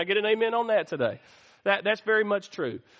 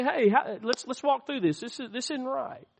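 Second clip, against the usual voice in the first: softer, higher, private one-on-one voice; kindly, hushed word to a friend; gentle loving correction; 4 semitones higher, 4 dB quieter than usual. Acted. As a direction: take them aside gently, trailing off very soft. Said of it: "with love, first one-on-one".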